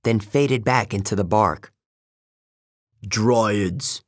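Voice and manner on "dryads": grumbling. Acted. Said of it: "Beckendorf grumbled".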